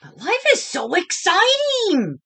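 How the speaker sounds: high, loud eager protest; bursting with excitement; excited Clark. A narrator's reading. Clark protesting to his teacher, high and eager. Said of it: "said Clark".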